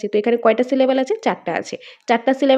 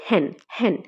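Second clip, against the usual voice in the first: হ্যান as an isolated beat, said lower; stressed syllable alone; 4 semitones lower, 4 dB quieter than usual. Shown as a demonstration. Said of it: "যে stress রয়েছে কোথায় পড়ে?".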